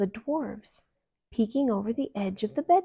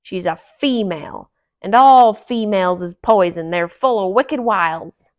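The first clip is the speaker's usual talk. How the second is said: loud, scornful grouch; gruff old dwarf warning the others off; cranky dwarf ranting about females; grumpy dwarf; 12 dB louder than usual. A narrator's reading.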